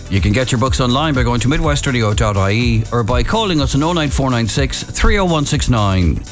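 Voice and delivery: ordinary talk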